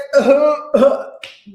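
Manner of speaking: loud burst of laughter; amused laugh. Real, not acted. Laughing at a cast member's bad rap, loud. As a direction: let a real laugh burst out.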